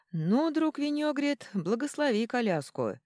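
slow, airy, pleased royal voice; a contented king blessing the carriage. A narrator's reading. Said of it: "довольно улыбаясь". (довольный король)

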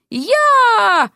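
high, drawn-out yelp; long wail cut short by a splash; falling cry. A narrator's reading.

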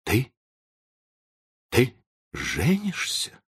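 slow, faltering disbelief; stunned babble. A narrator's reading. Professor learns of the engagement, babbling in shock. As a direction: falter with long gaps, voice lifting at the end. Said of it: "пролепетал он".

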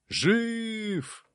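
high, joyful shout of a crowd; excited crowd cry. A narrator's reading. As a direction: cry out in amazed delight. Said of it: "загомонили они". (восторженный возглас толпы)

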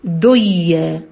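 دية said slowly with the nun merged into the ya; merged idgham. Shown as a demonstration. Teacher demonstrating the merged reading of دية, slow and drawn. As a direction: stretch out دية as one merged sound. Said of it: "لو أنا هضغم هتبقى إيه".

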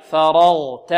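"فرغت" slow and loud, light fa, heavy ra and ghayn; "فرغت" drawn out with thin first syllable; articulation model. Shown as a demonstration. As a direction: sound out "فرغت" firmly for the class to repeat. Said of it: "انطقوا معي".

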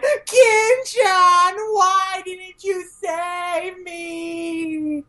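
high, slow, sing-song wailing plea; mock crying. Kagura covered in tentacles, mock sobbing plea to Gintoki. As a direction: wail it out like a weepy ghost. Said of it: "she's crying".

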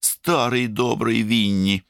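high, drawn-out affectionate saying; English-style fondness. A narrator's reading. Brother admiring Churchill on the radio, English-style fondness. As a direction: savour the nickname slowly, like an Englishman. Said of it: "приговаривал совсем как англичане".

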